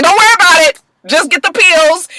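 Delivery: high, loud, impatient command; acted frantic insistence; urgent order. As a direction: cut off the questions with mock urgency. Acting out an urgent phone order.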